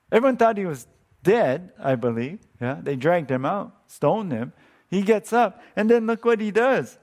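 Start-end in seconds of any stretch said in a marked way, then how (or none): none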